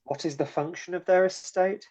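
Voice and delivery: normal talking voice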